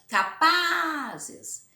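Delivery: capazes with PA held high and loud; stress on PA. Shown as a demonstration. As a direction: stretch out the PA of capazes. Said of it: "está na penúltima".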